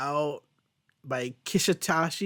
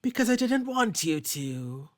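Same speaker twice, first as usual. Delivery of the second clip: slow, higher, knowing voice; sly Sarah; 5 semitones higher, slower than usual. Retold or imitated. Podcaster voicing Sarah answering Wolverine, slow and sly. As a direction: tease him slowly. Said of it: "Sarah's just like".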